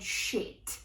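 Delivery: hushed, voiceless curse; inner-thought whisper. Retold or imitated. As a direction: whisper it as a private panicked thought. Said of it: "I thought in my head".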